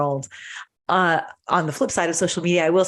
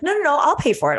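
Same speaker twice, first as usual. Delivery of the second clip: higher-pitched, mildly insistent quote; acting out a cashier waving off the objection; retold insistence; 8 semitones higher, much faster than usual.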